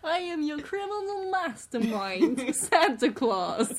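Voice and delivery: laughing mock Polish villain; Polish accent. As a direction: announce yourself as a criminal Santa in a Polish accent. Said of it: "my Polish accent".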